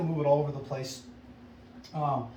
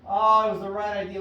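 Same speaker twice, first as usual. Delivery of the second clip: upbeat encouragement; 3 semitones higher, 7 dB louder than usual. Real, not acted. Repeats the cheer.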